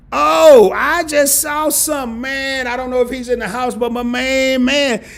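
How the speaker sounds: high, loud and thrilled; excited. Real, not acted. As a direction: burst out with excitement. Suddenly spots someone.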